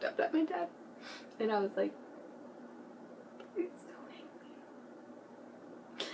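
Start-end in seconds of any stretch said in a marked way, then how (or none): 3.5-6.1 high, breathy whispered plea. Retold or imitated. Teen whispering to her angry dad in front of church. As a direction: beg him softly in a whisper.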